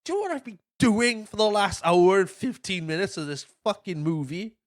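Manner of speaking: high, mock-exasperated rant; indignant kid. Kid telling off the Pagemaster, mock indignation. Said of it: "the kid's like".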